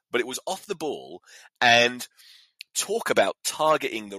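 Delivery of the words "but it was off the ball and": ordinary talk, nothing marked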